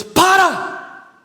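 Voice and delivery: sudden high shouted command; powerful voice of God. Retold or imitated. God interrupting a self-pitying prayer, powerful commanding shout. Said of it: "uma das vozes mais poderosas".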